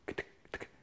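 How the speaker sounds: kt as bare voiceless clicks, no vowel; isolated kt ending. Shown as a demonstration. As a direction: tick out the consonants kt very softly. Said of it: "Vous entendez sur la fin le kt".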